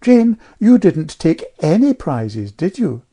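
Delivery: pointed needling. A narrator's reading. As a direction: needle a child sweetly and slowly. Rival mother needles Jane.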